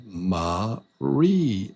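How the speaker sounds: "Maria" split into three spaced syllables; three separate beats. Shown as a demonstration. Teacher confirming there are three, separated syllables in "Maria". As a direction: count out each syllable of "Maria". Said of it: "There's three".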